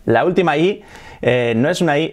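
usual speaking voice

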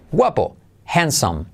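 normal talking voice

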